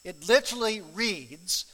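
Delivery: normal talking voice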